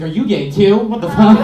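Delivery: mock outraged. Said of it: "I was like".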